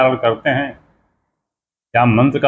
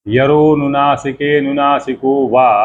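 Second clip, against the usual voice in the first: recited Sanskrit sutra; slower than usual. Shown as a demonstration. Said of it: "और दूसरा सूत्र".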